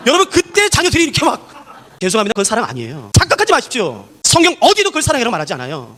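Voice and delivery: usual speaking voice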